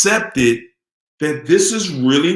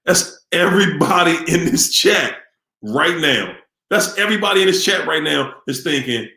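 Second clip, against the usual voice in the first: usual speaking voice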